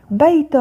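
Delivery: بيت called out high with an added -un ending; tanween damm. Shown as a demonstration. Teacher starting with the first word, tanween damm on بيت. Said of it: "ضم".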